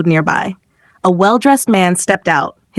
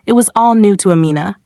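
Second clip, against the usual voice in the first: ordinary delivery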